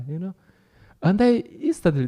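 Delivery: normal talking voice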